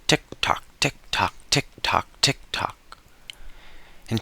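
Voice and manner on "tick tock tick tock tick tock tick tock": soft whisper, tick tock like a clock. Acted. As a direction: breathe it out like a ticking stopwatch. Stopwatch ticking in the story.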